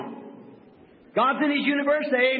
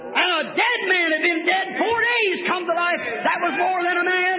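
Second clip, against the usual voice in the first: rising shouted sermon; full-force preaching; 5 semitones higher than usual. Real, not acted. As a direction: shout the miracle out to the congregation. Preaching climax on Lazarus.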